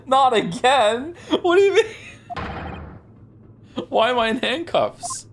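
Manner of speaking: high-pitched, incredulous outburst; loud exasperated disbelief. Real, not acted. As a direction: cry out in baffled protest. Outraged reaction to being framed again.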